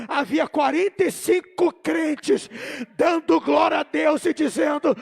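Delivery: loud, hammering repeat; emphatic preaching. Real, not acted. Preacher repeating the count of believers for the congregation, emphatic shout. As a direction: drive each word home at full voice.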